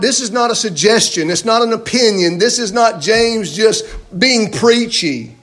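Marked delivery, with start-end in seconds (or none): none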